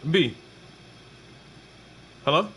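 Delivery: ordinary talk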